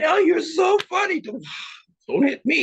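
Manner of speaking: very high, loud fawning; frightened suck-up. Acted. Sidekick flattering the bully to avoid a hit, high and panicky. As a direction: gush in mock panic, with a gasp before pleading. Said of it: "You know that character".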